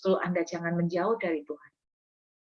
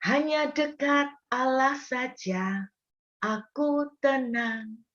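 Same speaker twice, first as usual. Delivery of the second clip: slow, hushed and calm, higher pitch; 5 semitones higher, much slower than usual. Acted. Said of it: "Daud juga berkata begini".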